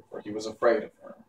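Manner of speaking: ordinary delivery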